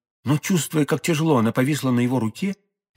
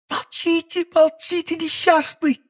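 much higher, alarmed woman's voice hushing him; frightened hush; slower than usual. A narrator's reading. Madame Bonacieux hushing d'Artagnan, high and frightened. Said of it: "воскликнула молодая женщина".

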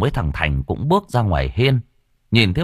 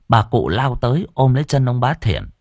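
plain reading voice

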